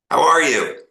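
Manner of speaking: retold hearty phone hello; hearty greeting. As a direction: greet him like an old friend. Dick Donner greets on the phone.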